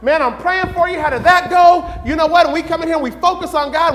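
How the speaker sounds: normal talking voice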